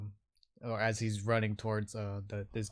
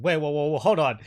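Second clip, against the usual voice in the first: loud, raised protest; alarmed guard; 5 semitones higher, 10 dB louder than usual. Acted. Guard halting a threatening orc, loud alarm. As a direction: stall him with loud, hurried whoas.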